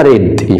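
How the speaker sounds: ريد said as English "red", with a short e; short "eh" vowel in ريد, not "ee"; variant pronunciation. Shown as a demonstration. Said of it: "وفي ناس بينطقوها".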